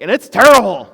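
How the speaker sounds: "terrible" blasted out at full volume; a sudden roar on "terrible"; blasting loud. Real, not acted. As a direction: belt "terrible" so loud it clips the mic. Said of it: "Sorry, I totally blew your speaker there".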